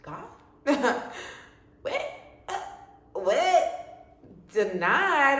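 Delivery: high, loud disbelieving what; shocked and searching for words; stunned disbelief. Retold or imitated. To God after the denial, stunned disbelief. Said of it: "I was speechless".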